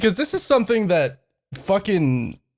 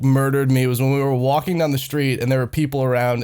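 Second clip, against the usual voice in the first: ordinary talk, nothing marked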